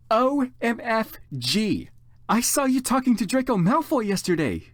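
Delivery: put-on high, gushing teen excitement, slow on the letters; best friend gushing about the boy, excited and high; excitedly. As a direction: squeal it out like gossip. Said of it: "she said excitedly".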